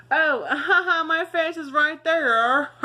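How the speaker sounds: high, loud burst of laughter through the words; loud, high-pitched giggly outburst; laughing. Real, not acted. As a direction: crack up while talking, stretching the words out. Startled laugh at own face on screen.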